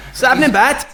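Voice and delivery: light mock Welsh accent; best Welsh accent. Guest showing off his best Welsh, mock Welsh accent. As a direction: put on a playful Welsh lilt. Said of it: "that's my best Welsh".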